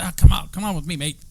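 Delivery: loud, high Australian call; bad Australian accent. Local guide beckoning tourists to follow, bad Australian accent. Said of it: "That's my worst Australian accent".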